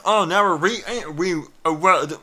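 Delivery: ordinary delivery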